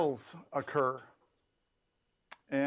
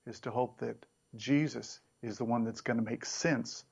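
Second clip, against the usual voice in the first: ordinary delivery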